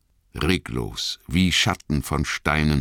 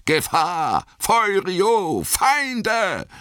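very high, drawn-out alarm cry; ringender Alarmruf; much slower than usual. A narrator's reading. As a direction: call out like a horn tearing through the night. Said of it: "bliesen das bockländische Hornsignal". (hoher, gedehnter Alarmruf wie ein Horn)

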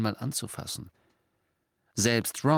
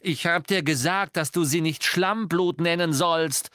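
higher, louder furious rebuke; sharp angry retort, raised voice; angry snap; 9 semitones higher, 4 dB louder than usual. Acted. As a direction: snap at the elf in anger. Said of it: "fauchte Harry".

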